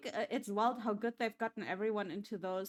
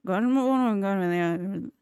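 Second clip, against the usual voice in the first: loud, blaring station-speaker German; imitating German train announcement; 8 dB louder than usual. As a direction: blast it like a booming platform announcer. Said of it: "all I hear is".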